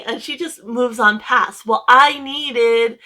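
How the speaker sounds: normal talking voice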